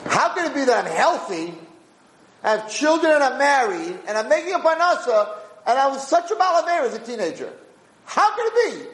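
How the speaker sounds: imitating an awed, undeserving man; mock amazed gratitude. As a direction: marvel aloud as if you can't believe it. Imagined thankful believer marvelling.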